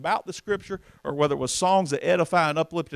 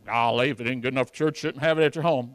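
mimicking a critic; 2 semitones lower than usual. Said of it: "my brothers and sisters to be critical".

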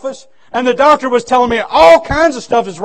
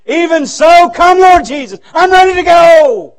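joyful readiness; 5 semitones higher, 6 dB louder than usual. Retold or imitated. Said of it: "I said".

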